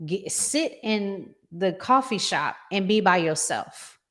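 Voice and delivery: ordinary talk, nothing marked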